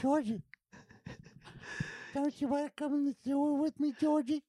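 low, hushed creepy clown lure; creepy Pennywise voice. Acted. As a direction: coax softly like a sinister clown. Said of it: "[name], this is really good".

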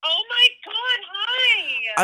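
very high, slow thrilled gasp; overwhelmed fan squealing hello; starstruck. Real, not acted. Fan realizing her idol called, high and thrilled.

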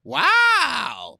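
high, loud, drawn-out wow; amazed. Real, not acted. Cohost hears how the spanking story ended, loudly amazed. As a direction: belt it out in astonishment.

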